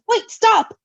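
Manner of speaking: loud, high cry to stop; shouted plea. Acted. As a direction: yell after them to wait. Characters crying out to stop Roman.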